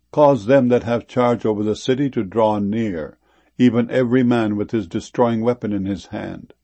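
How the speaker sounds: ordinary delivery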